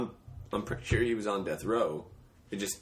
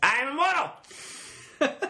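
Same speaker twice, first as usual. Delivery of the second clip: very high, slow, grandiose proclamation; Charles Manson impression; slower than usual. Picking the note back up after a freeze, high Manson impression. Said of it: "this is me doing Charles Manson".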